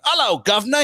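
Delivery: high, loud British greeting; hammed-up British accent, pitched well up; mock British accent. Retelling a hero workshopping voices, hammed-up British accent. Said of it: "You chose to be British in Gotham".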